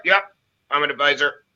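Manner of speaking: low, soft, dead-tired sales patter; mock lifeless advisor pitch, rushed at the end; lifeless old advisor. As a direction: drone it out like a bored old insurance man. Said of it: "They were, like, dead".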